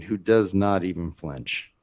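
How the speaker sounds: ordinary delivery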